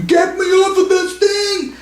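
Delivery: high, slow, hammed-up yell of panic; mock panicked scream. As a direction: play the dad screaming to be let off the ride. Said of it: "my dad was screaming".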